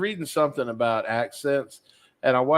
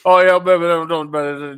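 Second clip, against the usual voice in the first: mock Cajun; 3 semitones higher, 7 dB louder than usual. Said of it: "Maybe Cajun?".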